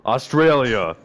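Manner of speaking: pumped-up mock Australian accent. Shown as a demonstration. Friend pumping himself up to talk like them, in mock Australian accent. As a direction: call it out like a hammed-up Australian. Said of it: "talk like them".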